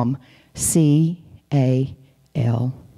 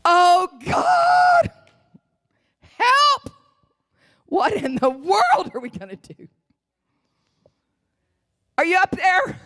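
high, wailing cry up to heaven; acted panicky prayer, very high; stressed prayer; slower than usual. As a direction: plead frantically with God, pitch soaring. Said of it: "my prayers are in stress".

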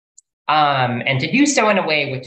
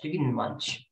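much softer muttered repeat; quiet mutter; 8 dB quieter, slower than usual. Real, not acted. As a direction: mutter it to yourself.

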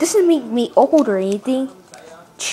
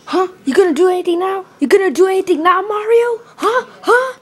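fast, high badgering; aggressive taunting; 6 semitones higher, 4 dB louder than usual. Luigi threatening beaten Mario, taunting aggressively. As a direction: fire questions at him, voice climbing.

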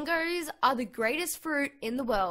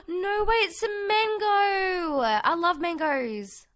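higher, louder, slow gasping delight; excited shout; 6 semitones higher, 7 dB louder, slower than usual. Real, not acted. Spotting a mango tree in the game, excited gasping shout. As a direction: squeal with joy at the find.

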